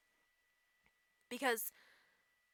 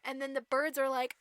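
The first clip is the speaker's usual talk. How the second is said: normal talking voice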